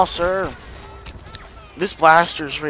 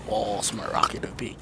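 ordinary talk

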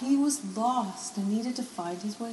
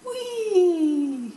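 much higher, louder joyful cry; gleeful whinny; 11 semitones higher, 8 dB louder than usual. Acted. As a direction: squeal with fun sliding down. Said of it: "Helix whinnied".

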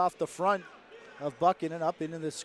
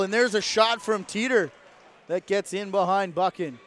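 excited shot call; 3 semitones higher, 6 dB louder than usual. Real, not acted. Calling a shot on goal.

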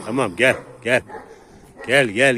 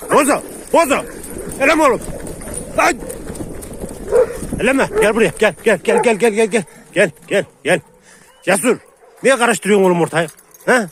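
yelling to stop a dogfight; 10 semitones higher, 4 dB louder than usual. Real, not acted. Stopping a dog fight.

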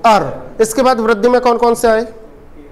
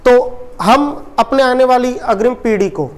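usual speaking voice

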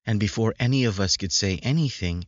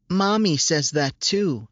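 put-on high, slow child's voice; a small girl telling him happily; little girl; 8 semitones higher than usual.

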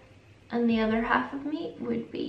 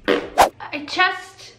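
the voice suddenly breaks mid-word; voice cracking; 8 semitones higher, 7 dB louder than usual. Real, not acted. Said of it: "where did my voice go?".

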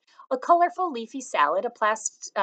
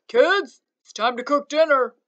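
loud call across the house, higher; hollered; 5 semitones higher, 9 dB louder than usual. A narrator's reading. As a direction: holler up the stairs to the kids.

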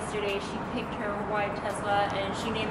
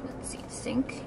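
whispering; 2 semitones higher, 5 dB quieter, slower than usual. Real, not acted. Demoing climate controls.

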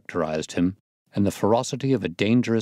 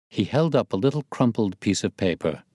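plain reading voice

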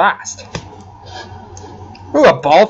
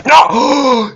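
high, louder yell of dismay; dismayed cry; 9 semitones higher, 4 dB louder than usual. Real, not acted. Player protests a sudden failure in the game, dismayed cry. Player loses his progress.

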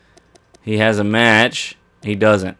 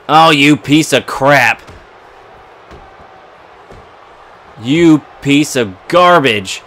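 slow mock scorn; 3 semitones higher, slower than usual. Insulting the opponent after losing.

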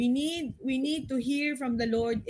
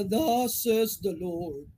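slow, booming prophet proclamation, halved pace; mock old prophet; 3 semitones lower, 3 dB louder, much slower than usual. Preacher imitating old-style prophets, slow thunderous mock proclamation. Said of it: "meron tayong ganito".

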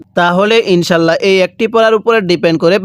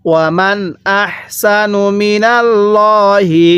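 slow, drawn-out tajweed chant; Quran recitation; 2 semitones higher, much slower than usual. Shown as a demonstration. Teacher recites the verse for students, slow tajweed chant. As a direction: intone each syllable slowly like a reciter. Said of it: "তাহলে".

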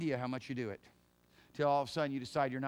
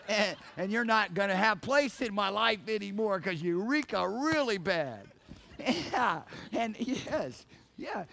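loud, laughing, higher preaching burst; laughing excitement; 4 semitones higher, 6 dB louder, slower than usual. Real, not acted. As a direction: laugh through it with rising energy.

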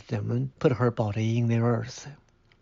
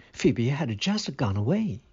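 ordinary delivery, nothing marked

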